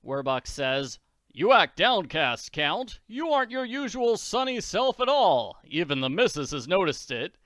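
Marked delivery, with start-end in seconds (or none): 1.3-7.3 louder, higher jolly taunting; mocking Daddy. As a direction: tease the count cheerfully.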